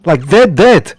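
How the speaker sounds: high loud panicked wail; panicked grief. Acted. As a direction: repeat it in rising panic. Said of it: "that Sergei says".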